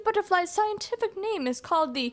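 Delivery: a hint of a fussy, enthusiastic owl professor; lightly voiced Blathers. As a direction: read the museum owl's lines with mild wonder. Reading owl character's lines.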